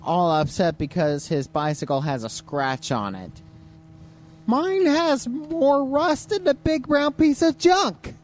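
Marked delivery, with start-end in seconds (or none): 4.5-8.1 high, slow mock complaint; mocking whiny voice. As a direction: whine it like a spoiled kid.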